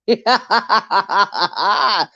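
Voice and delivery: short laughing fit; amused chuckling; real laughter. Real, not acted. Laughing at own complaint.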